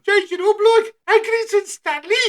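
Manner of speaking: high, loud altered voice; voice change. Acted. Manager's voice suddenly changed high and loud. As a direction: pitch the voice way up. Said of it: "What the hell did you just do to me?".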